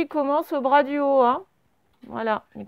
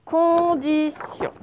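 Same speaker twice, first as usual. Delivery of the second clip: slow, raised pitch, drawn-out syllables; 4 semitones higher, much slower than usual. Shown as a demonstration. Teacher repeats second word for writing, syllable by syllable. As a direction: repeat it slowly, stretching each syllable. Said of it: "fonctionnez bien syllabe par syllabe".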